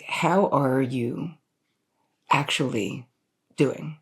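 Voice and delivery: slow, deliberate, with pauses between phrases; weighted, unhurried, earnest question; sincere check-in. Shown as a demonstration. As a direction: ask it slowly and really mean it. Same question asked in earnest.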